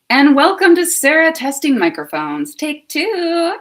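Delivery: loud, high presenter welcome; show intro. Pretend. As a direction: announce it big, like opening a show. Second take of test intro.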